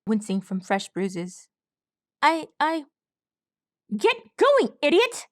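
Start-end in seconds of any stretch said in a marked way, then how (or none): 2.2-2.9 high, faltering stammer. A narrator's reading. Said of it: "Swab stammered". 3.9-5.3 high, loud snarl. A narrator's reading. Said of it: "Hoonie snarled".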